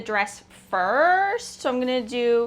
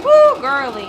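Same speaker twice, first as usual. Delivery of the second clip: celebratory whoop; 3 semitones higher, 12 dB louder, slower than usual. Real, not acted. Sewer celebrating a fixed machine, loud gleeful whoop.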